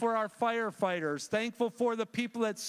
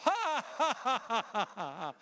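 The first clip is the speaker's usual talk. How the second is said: imitating infectious laugh; 3 semitones higher than usual. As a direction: copy the father-in-law's big laugh. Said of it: "my father-in-law had this infectious laugh".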